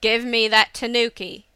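loud, commanding order; angry demand. A narrator's reading. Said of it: "he demanded".

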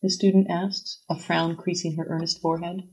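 ordinary delivery, nothing marked

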